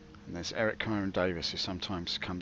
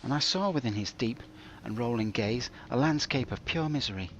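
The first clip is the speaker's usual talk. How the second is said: pompous storybook narration; melodramatic narration; 2 semitones higher than usual. Pretend. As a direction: spin a mock-tragic tale.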